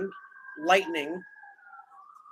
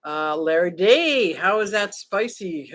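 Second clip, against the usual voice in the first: normal talking voice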